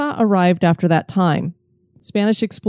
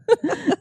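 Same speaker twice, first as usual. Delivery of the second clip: quick high laugh, soft; a quick amused laugh; short laugh; 11 semitones higher, 3 dB quieter than usual. Real, not acted. Teasing laugh after praise.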